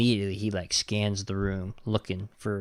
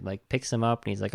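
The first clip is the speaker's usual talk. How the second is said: normal talking voice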